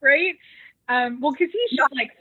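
ordinary delivery

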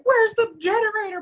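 high, panicked squeal; freaking out. Retold or imitated. Dubenich freaking out in the blackout, high panicked squeal. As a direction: yelp it as the lights die. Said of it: "Dubenich starts freaking out".

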